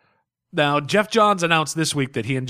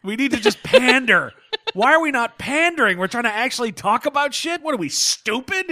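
mock outraged rant; 11 semitones higher, 3 dB louder than usual.

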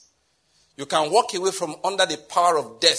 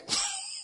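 a short, high, soft giggle; high squeaky giggle; 10 dB quieter than usual. Real, not acted. Preacher giggling at his own joke to the congregation, high and soft.

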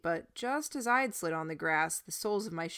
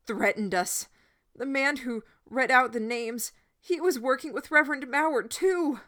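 loud pleading; 5 semitones higher, 7 dB louder than usual. A narrator's reading. Boy pleading loudly with crowd.

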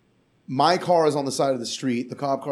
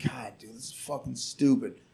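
muttered annoyance; 2 semitones lower, 8 dB quieter than usual. Retold or imitated. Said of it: "I'm like".

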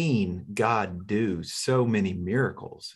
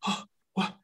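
stunned whisper; 8 dB quieter than usual. Retold or imitated. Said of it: "she just has this bewildered look".

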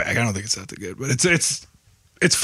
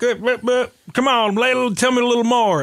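higher-pitched impression of a rockabilly hustler; rockabilly singer; 10 semitones higher than usual. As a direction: imitate a pushy rockabilly musician, pitched up. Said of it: "he's all kinda like".

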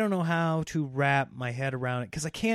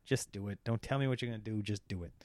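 exasperated hush; 6 semitones lower, 8 dB quieter than usual. Retold or imitated. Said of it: "You're like".

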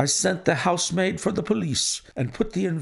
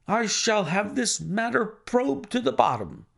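high, vehement vow; grief and rage; 7 semitones higher than usual. A narrator's reading. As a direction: vow it in high-pitched, understated fury. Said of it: "half mad with grief and rage".

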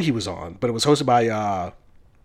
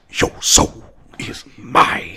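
cold hissing slow whisper; slow, sinister whispered villain line; Shang Tsung; much slower than usual. Retold or imitated. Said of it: "Shang Tsung".

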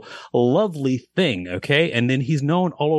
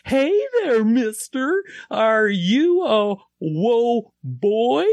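very high, slow, sing-song clown voice; 11 semitones higher, much slower than usual. Acted. Clown teasing a dad in the crowd, high and slow. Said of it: "the clown goes".

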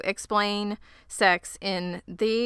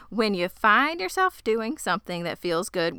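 usual speaking voice

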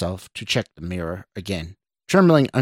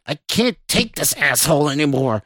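louder, higher, fed-up outburst; growled; 5 semitones higher, 4 dB louder than usual. A narrator's reading. Stranger threatens to take over from Scott, growling. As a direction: build to an angry threat. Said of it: "the stranger growled".

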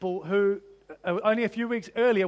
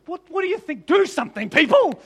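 higher, impatient prodding; impatient Jesus; 7 semitones higher, 5 dB louder than usual. Pretend. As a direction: urge the stunned crowd to act. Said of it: "was he like".

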